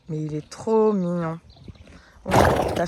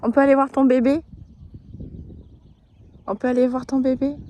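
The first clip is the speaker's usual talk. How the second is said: sweet talk to animal; 5 semitones higher than usual. Real, not acted. Talking sweetly to a mare.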